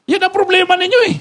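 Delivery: high-pitched comic reproach; mock scolding. Pastor telling members off over their problem, mock scolding. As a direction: throw the blame back, voice pitched right up.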